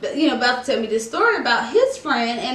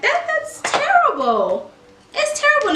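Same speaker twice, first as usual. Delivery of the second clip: dramatic wail; 14 semitones higher than usual. Pretend. Vlogger playing up her heartbreak, high dramatic wail. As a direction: stretch it up high, playing the tragedy.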